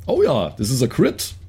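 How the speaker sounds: usual speaking voice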